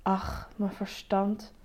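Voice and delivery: slow, soft, dismissive murmur; whispered. A narrator's reading. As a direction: murmur it slowly, brushing it off. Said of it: "fluisterde hij".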